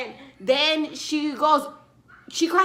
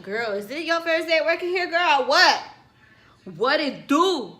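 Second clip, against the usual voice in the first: mock the waitress with attitude; sassy retort; 3 semitones higher, 7 dB louder than usual. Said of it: "girl, I was like".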